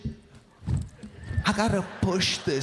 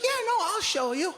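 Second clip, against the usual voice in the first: much higher, light and unbothered; wife's breezy reply. Retold or imitated. Wife agreeing to show damage, breezy. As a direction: toss it off like a carefree wife. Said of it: "She's like".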